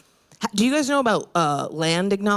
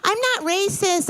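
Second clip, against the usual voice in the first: put-on high defensive girl; 11 semitones higher, slower than usual. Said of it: "They're like".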